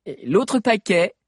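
put-on bright teen girl voice; girl character voice. A narrator's reading. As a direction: voice a chatty teenage girl. Sabi's girlish character voice.

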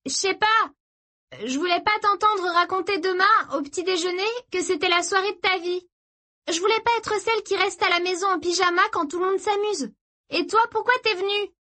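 high-pitched sisterly retort; defensive younger sister. Acted. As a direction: argue back high, over the music. Narrator voices the sister's retort. (petite sœur sur la défensive, voix aiguë)

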